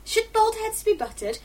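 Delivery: high-pitched teasing uncle voice. Acted. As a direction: quote the uncle in a high lilt. Quoting Uncle Eddie in the reading.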